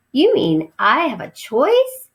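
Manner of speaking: slow, disbelieving mouse question; can't believe his luck; incredulous. Acted. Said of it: "he asked, incredulous".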